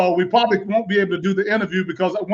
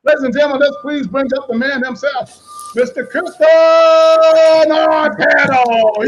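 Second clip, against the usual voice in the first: ring announcer intro; 4 semitones higher, 4 dB louder than usual. Acted. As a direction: bring the fighter up like a ring announcer. Host introduces guest like announcer.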